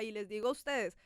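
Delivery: normal talking voice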